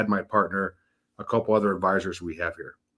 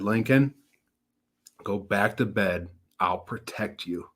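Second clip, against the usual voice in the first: slow, softening bedtime comfort from dad; retold tender reassurance; 4 dB quieter than usual. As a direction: gently settle a scared child, slowing right down. Retold dad soothing crying son at night.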